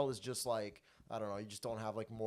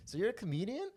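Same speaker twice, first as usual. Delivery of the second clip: sweet lady voice; 10 semitones higher than usual. Retold or imitated. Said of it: "innocent, sweet lady goes".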